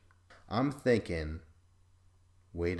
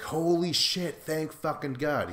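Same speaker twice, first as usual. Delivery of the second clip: high, excited mock relief; imitating a relieved contestant; relieved outburst; 6 semitones higher than usual. [name] reacts with relief.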